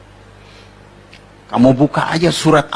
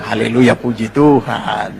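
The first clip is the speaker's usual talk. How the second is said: mocking; 5 semitones lower, slower than usual. Shown as a demonstration. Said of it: "Jangan cuma bilang".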